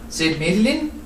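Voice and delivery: plain reading voice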